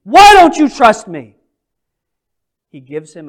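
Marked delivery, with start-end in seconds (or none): 0.0-1.4 impatient rebuke. Retold or imitated. Instead of saying.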